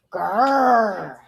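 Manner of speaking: lion growl. Acted. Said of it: "said the young lion".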